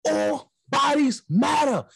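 drawn-out yell from a passing car; slow jeering shout from the car; slow shouted taunt. Retold or imitated. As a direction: bellow it slowly, mocking. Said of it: "I was like".

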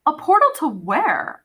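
put-on a girl demanding an answer. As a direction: insist on an answer. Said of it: "I demanded".

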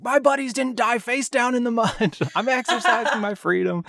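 high-pitched imitation of an indignant rant; mock yelling. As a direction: yell it like an outraged movie veteran, without full volume. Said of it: "he's yelling at the waitress".

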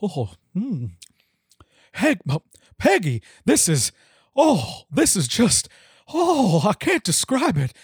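lightly voiced drawling Texan dad; a hint of Hank Hill. Hank impression in fanfic reading.

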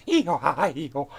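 high hee-haw bray; failed robot noise. Shown as a demonstration. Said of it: "It's as close as I can get".

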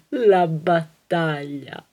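slow, weighty, theatrical tragedy line; slow dramatic repeat. Acted. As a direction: deliver it like a doomed heroine. (lenta e teatrale, come una tragedia)

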